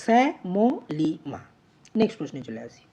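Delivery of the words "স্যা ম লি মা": স্যা, ম, লি, মা each apart, stepping down in pitch; syllable by syllable. Shown as a demonstration. Teacher recapping four open syllables, syllable by syllable. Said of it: "চারটি মুক্ত দল আছে".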